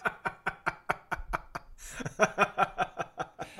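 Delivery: breathless high-pitched laughing fit; helpless high laugh. Real, not acted. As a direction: collapse into a high wheezing laugh. Laughing at the quote.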